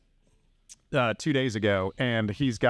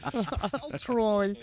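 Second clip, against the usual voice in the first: mock British try; 10 semitones higher than usual. Repeats in put-on British accent.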